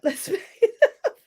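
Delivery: laughing through it, pitch up; amused laughing repeat. Real, not acted. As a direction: chuckle the words out.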